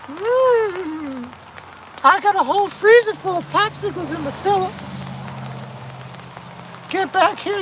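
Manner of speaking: very high, thin falsetto character; put-on character voice. Character calling someone back to the cellar, high falsetto. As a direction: call out in a high falsetto, softening then jumping up.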